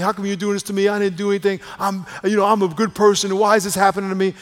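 high, loud, aggrieved protest; complaining. Acted. Good person protesting to God, complaining. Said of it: "And we're complaining about what's happening".

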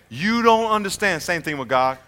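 ordinary talk

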